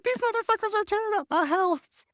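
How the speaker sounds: put-on very high, squeaky indignant old lady; outraged ghost lady. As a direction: shriek it like a ghost whose house is wrecked. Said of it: "She's like".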